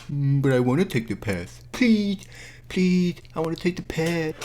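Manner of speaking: high, pouty begging; mock whining. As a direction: imitate a whining brat pleading. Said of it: "pouts and cries and whines".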